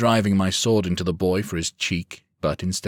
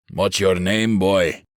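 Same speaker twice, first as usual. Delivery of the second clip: louder, menacing demand; growling; 6 dB louder, slower than usual. A narrator's reading. Lek threatening the captured boy, growling. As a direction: lean in and growl it. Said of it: "Lek growls from behind me".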